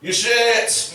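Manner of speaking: drawn-out boo and jeer from the crowd; imitated heckle. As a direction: heckle the singer like an audience member. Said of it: "every now and then I just hear".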